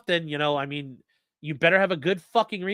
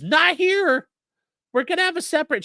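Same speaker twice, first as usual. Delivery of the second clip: high-pitched, louder mock objection; mock protest; 12 semitones higher, 4 dB louder than usual. Retelling a lawyer objecting on the record, high mock protest. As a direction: imitate him protesting in a raised, squeaky-high voice.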